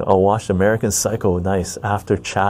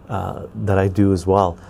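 usual speaking voice

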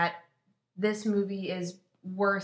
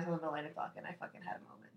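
hushed, breathless whisper of awe; 3 semitones lower, 11 dB quieter, faster than usual. Real, not acted. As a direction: drop to a whisper as if sharing a secret. Confides her awe at the Falcon.

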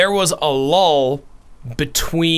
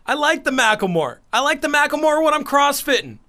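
gym bro; 8 semitones higher than usual. Retold or imitated.

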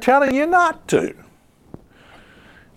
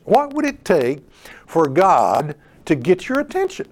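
ordinary delivery

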